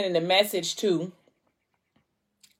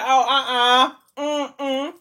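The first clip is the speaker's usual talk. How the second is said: nope, not today; 6 semitones higher, much slower than usual. Retold or imitated. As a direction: shut it down with a high mm-mm. Narrator shutting the situation down.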